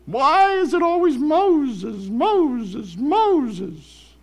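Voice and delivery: high, slow jealous whining; jealous whine. Retold or imitated. Mimicking jealous Miriam.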